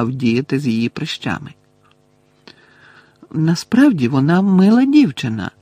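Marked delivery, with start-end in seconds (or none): 3.3-5.5 higher, pleading defence of a friend; mildly defensive, pitch lifted; making excuses. A narrator's reading. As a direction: plead lightly on someone's behalf. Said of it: "виправдовувалася Чо".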